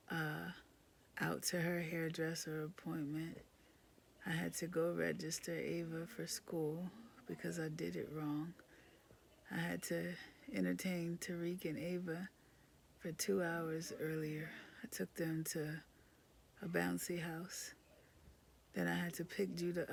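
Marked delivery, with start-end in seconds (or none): 1.2-3.4 whispering. Real, not acted. Exhausted whisper. 14.8-17.7 whispering. Real, not acted. Exhausted whisper.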